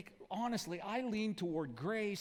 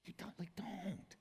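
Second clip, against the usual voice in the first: whispered, hushed plea; whispered hush from a gentle Jesus; 3 semitones higher, 6 dB quieter than usual. Pretend. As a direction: hiss it softly so only they hear. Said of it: "comes along".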